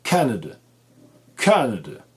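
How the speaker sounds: Canada falling from CAN into two weak schwas; stressed CAN, schwa endings. Shown as a demonstration. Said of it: "ce son vocalique non accentué".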